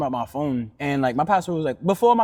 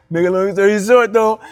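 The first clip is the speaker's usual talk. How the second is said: louder, laughing through the line; laughing retake; 6 semitones higher, 8 dB louder than usual. Real, not acted. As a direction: restart it with a laugh at yourself. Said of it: "like I said that, like, a time".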